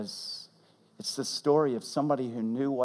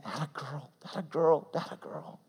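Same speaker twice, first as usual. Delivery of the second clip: much softer, fading to a whisper; hushed encouragement; 7 dB quieter than usual. Retold or imitated. Dad softly encouraging his little girl, fading to a whisper. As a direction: breathe it out tenderly. Soft retelling of the officer's encouragement.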